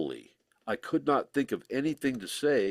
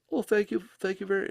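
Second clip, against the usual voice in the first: higher, a touch of posh English thanks; a lightly put-on English accent, pitched up; mock English accent; 10 semitones higher than usual. [name] imitates Billy thanking him in a mock English accent. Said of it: "of course, he's not English".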